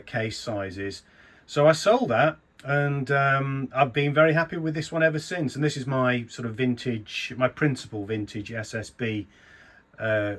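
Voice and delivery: normal talking voice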